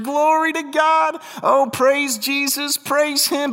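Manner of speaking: high, slow, syrupy mock praise; mock pious churchgoer. Preacher mimicking a fake pious churchgoer at church, high and slow. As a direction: ham up a fake holy churchgoer.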